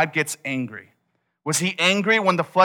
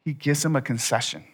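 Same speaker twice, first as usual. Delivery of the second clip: ordinary talk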